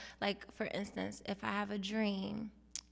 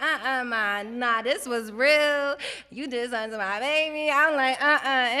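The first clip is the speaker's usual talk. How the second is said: louder, insistent accusing; firm refusal; 4 semitones higher, 13 dB louder than usual. Retold or imitated. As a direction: stand your ground against mom.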